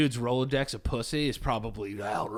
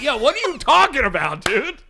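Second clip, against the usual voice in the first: high, loud incredulous outburst; mock bafflement; 11 semitones higher, 5 dB louder than usual. Fan reacting to baffling lyrics, mock-incredulous. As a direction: pitch it up, baffled and emphatic.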